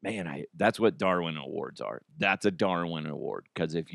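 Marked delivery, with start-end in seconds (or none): none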